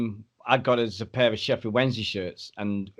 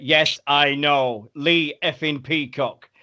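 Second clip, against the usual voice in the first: very slow, deliberate acknowledgement; drawn-out, long-suffering reply; weary resignation; 3 semitones higher, much slower than usual. Retold or imitated. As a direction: spell it out as an old joke. Acts out idol's weary reply.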